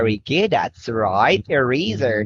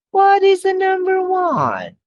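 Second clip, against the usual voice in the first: high playful. Acted. Teacher's high playful question.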